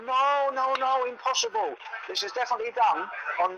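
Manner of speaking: hammed-up Polish accent, protesting; mock Polish driver insisting, in a Polish accent; terrible Polish accent. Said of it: "I'll do a terrible Polish accent".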